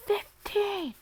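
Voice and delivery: high squeaky confession of a number; a high cartoonish reply; high silly voice. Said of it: "he's like".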